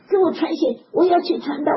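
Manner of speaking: loud, high insistent plea; 我要去传道 called out loud; eager shout. Retold or imitated. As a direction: insist loudly you must go preach. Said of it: "喊叫".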